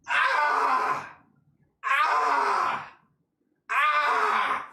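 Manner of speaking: high strained exertion yell; hammed-up high "ah" on each rep; push-up effort yells. Counting out three push-ups.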